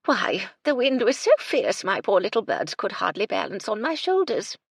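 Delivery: put-on high, loud chirpy old lady; chirped. Lady Belville complaining of the weather, high chirpy. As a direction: twitter it like a dotty dowager. Eccentric widow on her birds.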